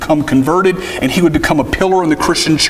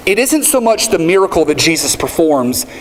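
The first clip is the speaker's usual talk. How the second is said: ordinary talk, nothing marked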